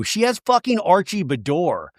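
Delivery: ordinary delivery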